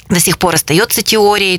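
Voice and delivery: normal talking voice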